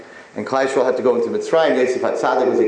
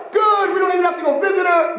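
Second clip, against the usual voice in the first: high, crowing shout; hammed-up callous delight; gleeful relief. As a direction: shout it high, thrilled to be spared. Relative glad of distant grave.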